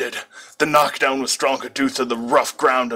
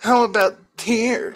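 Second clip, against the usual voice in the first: put-on teasing girl; 10 semitones higher than usual. A narrator's reading. Kogasa teasing line.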